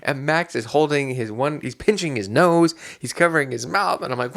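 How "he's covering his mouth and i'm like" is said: usual speaking voice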